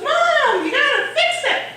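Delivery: put-on high, loud kid's demand; pleading child. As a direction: imitate a child wailing for Mom. Child pleading loudly with mother.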